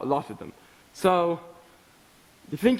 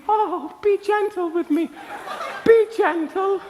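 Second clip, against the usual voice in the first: much higher, hammed-up girlish plea through laughter; mock husky pleading; 10 semitones higher than usual. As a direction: mimic a swooning girl begging, laughing. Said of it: "her voice may appear somewhat husky".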